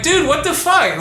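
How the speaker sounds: high disbelieving dude; incredulous outburst. Retold or imitated. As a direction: react like you can't believe your ears. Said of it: "and I'm like".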